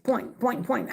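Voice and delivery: ordinary delivery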